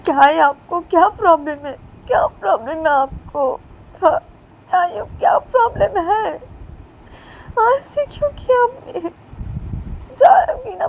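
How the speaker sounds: high, loud shouting, turning tearful; woman yelling at the mother; yelling back. Acted. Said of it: "चिल्ला देती है".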